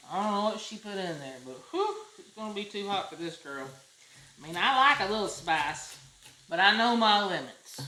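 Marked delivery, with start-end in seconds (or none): none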